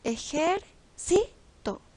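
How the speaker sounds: ejército slowly split, CI high and loud; drawn-out syllables, hit CI in ejército; stress on penultimate syllable. Shown as a demonstration. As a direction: say ejército as e-jer-CI-to. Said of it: "en la penúltima parte".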